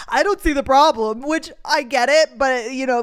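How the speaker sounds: gushing with delight, voice up; excited gush. Real, not acted. To her viewers, gushing about separate bedrooms, excited and loud.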